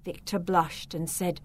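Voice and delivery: plain reading voice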